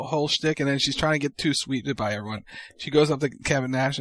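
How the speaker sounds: ordinary delivery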